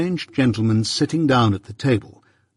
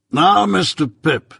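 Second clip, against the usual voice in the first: stern lawyer voice, a bit higher; Jaggers; 8 semitones higher, 4 dB louder, slower than usual. Acted. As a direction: weigh each word like a London lawyer.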